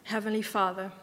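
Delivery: ordinary delivery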